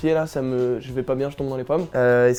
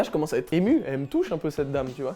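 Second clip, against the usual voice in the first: ordinary talk, nothing marked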